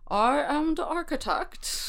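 hint of a slow Scottish accent; lightly voiced slow Scottish accent. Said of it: "how well I can do a Scottish accent".

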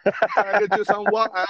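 very high mock English accent; hammed-up English voice. Shown as a demonstration. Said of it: "the only thing I could say with the accent".

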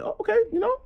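high squeaked oh okay; mock pleased surprise. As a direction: play the quick shrug of acceptance. Retelling agreeing to bring her.

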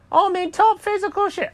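very high-pitched cartoon bragging; boasting cat. Cat asked if he can make it, high boastful voice.

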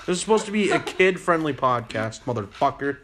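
ordinary delivery

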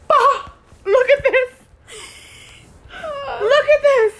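high, loud mock outburst; hammed-up, shrieky exclaiming; dramatic outburst. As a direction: imitate someone making a big dramatic scene. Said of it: "like, so dramatic".

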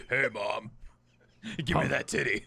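deep-voiced newborn, played for laughs; put-on low man's voice for a baby; Bert. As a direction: drop low and deadpan. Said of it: "I don't think Bert's voice was ever high".

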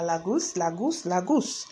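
"Lagos" as LAH-goose, repeated with lifted endings; mimicked nickname. Campers' nickname for the girl, "Lagos" as Lah-goose. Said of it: "started calling her Lagos".

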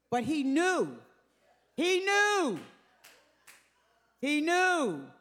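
preacher's climbing refrain; building repetition. Acted. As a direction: build each "knew" into a peak.

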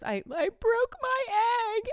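put-on high, slow wailing kid voice; mock sobbing child; imitating a crying child. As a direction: wail it like a heartbroken little girl. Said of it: "the saddest cry and goes".

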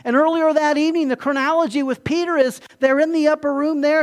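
usual speaking voice

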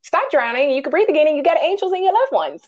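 lightly voiced rapturous Zora; imitating Zora. Host retells Zora's theory of death, lightly voiced and excited. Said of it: "She was like".

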